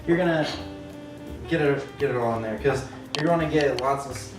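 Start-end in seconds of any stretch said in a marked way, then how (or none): none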